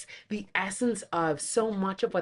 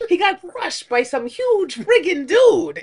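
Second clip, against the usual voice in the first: higher, louder put-on voice; acted movie quote; 8 semitones higher, 10 dB louder than usual. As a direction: throw out the line like the actor. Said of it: "quoting movie line".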